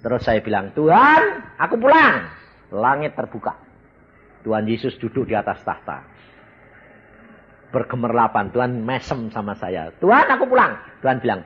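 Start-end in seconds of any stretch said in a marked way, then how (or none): 0.7-2.3 higher, louder plea upward; calling out. Retold or imitated. Man at death calling to Jesus, a loud high call. 10.0-10.8 higher, louder plea upward; calling out. Retold or imitated. Man at death calling to Jesus, a loud high call.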